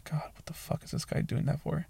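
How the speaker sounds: hushed aside, as if only thinking it; whispered thought. Retold or imitated. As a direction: whisper it to yourself in disbelief. Retold inner thought during needle poke.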